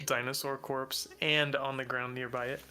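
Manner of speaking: usual speaking voice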